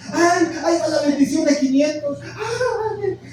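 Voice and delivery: high, laughing mock whine over the money; mock longing laugh. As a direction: play a greedy believer, half laughing. Mocking chasing blessings. (lamento burlón entre risas, agudo)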